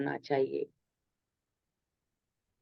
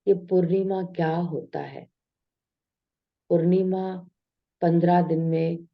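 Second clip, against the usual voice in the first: ordinary talk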